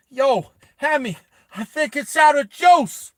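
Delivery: high, loud shouted quip; loud comic call. Acted. Vas turns around to Hammy, high loud call. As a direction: holler it over to a mate.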